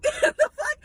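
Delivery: high squeal of laughing disbelief; laughing outburst. Real, not acted. Laughing at a pushy customer, high incredulous squeal.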